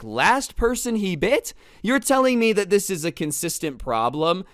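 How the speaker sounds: high, loud disbelief; stretched-out, high-pitched mock alarm; incredulous outburst. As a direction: blurt it out as if you can't believe your ears. Inner outburst after feigning calm.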